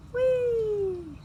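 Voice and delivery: high joyful whoop; gleeful whee. Acted. Little robin zooming through the sky, gleeful. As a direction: let it fly out with glee.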